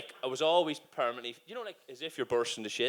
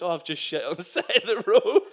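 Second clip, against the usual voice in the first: laughing; 7 semitones higher, 8 dB louder than usual. Retold or imitated. Confessing to his wife, laughing.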